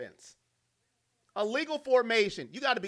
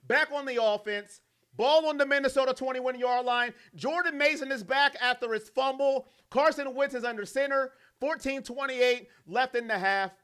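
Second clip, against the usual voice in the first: lively game calling, pitched up; play-by-play; 4 semitones higher than usual. Real, not acted. A streamer calling the Vikings drive for his chat, play-by-play. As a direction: call the drive like a broadcaster.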